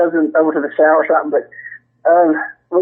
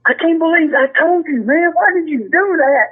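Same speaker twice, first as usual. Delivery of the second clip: higher, mock outraged scolding; imitating Blackie; 8 semitones higher than usual. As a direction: pile the blame on the rookie. Retold fake outraged accusation.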